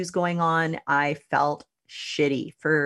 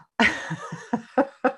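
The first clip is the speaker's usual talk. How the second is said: laugh; 8 semitones higher than usual. Real, not acted. Laugh after her own answer.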